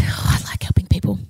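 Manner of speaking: unvoiced whispered aside. Real, not acted. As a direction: breathe it under the breath.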